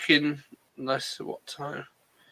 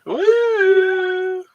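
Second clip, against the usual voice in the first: long high woo into the mic; high whoop; 14 semitones higher, 5 dB louder than usual. Acted. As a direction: hold a high woo to test the echo. Testing the echo effect.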